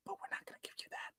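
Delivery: fast, breathless whispered aside; a quick conspiratorial whisper to the audience; teasing whisper. Acted. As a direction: whisper it quickly, like a sly secret. Teasing aside about cherry flavor.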